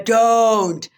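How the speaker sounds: a short, mock-stern shout; playful shout. Real, not acted. Shouting at Sbuda Fire in the chat, playful loud. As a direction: call out to stop them.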